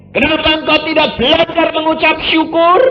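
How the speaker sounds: high, loud preacher's cry of thanks; fiery preaching. Acted. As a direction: preach it out at the top of the voice. Preaching at full force. (khotbah berapi-api dengan suara tinggi dan keras)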